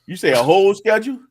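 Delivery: loud, high, rising disbelief; incredulous echo. Real, not acted. To a friend whose schedule got planned out, incredulous. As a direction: question it as if it's absurd, pitch climbing.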